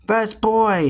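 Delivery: put-on storybook boy's mild shout. A narrator's reading. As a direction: call it out high, without much volume. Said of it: "shouted Henry".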